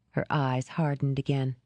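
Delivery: plain reading voice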